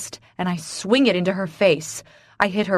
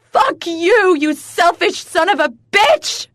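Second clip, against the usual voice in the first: high, loud screaming curse; furious scream; 11 semitones higher, 8 dB louder than usual. Acted. Wife screams at her distant husband in fury. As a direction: scream it at him with everything. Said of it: "I scream".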